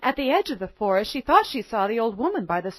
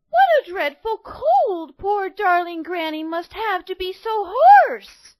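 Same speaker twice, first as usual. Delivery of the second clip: put-on high, louder, slow worried girl voice; 9 semitones higher, 6 dB louder, slower than usual. A narrator's reading. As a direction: fret like a little girl. Girl worries about granny.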